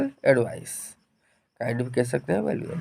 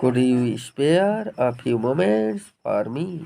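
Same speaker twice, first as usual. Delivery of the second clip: slow model phrase; 2 semitones lower, much slower than usual. Shown as a demonstration. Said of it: "मतलब".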